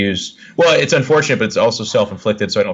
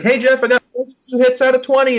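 much higher, cartoonish bragging voice; mocking opponent's boast; 14 semitones higher than usual. Player imitating cheating opponent bragging about rolls, high mock voice. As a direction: parody a rival's absurd dice claim. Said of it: "And he's like".

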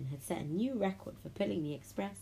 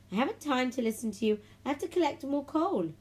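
higher, louder sulky protest; pouting; 7 semitones higher, 5 dB louder than usual. A narrator's reading. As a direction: pout and push the pitch up. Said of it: "pouted Emily".

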